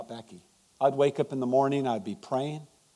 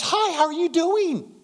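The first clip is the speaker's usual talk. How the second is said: cheery greeting; 5 dB louder than usual. Retold or imitated. Becky's cheerful greeting retold.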